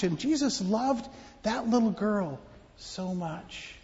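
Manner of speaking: ordinary delivery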